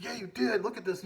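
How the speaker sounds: high-pitched, insistent retort; pissed off. Retold or imitated. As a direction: imitate the hurt player arguing back. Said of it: "He got pissed too".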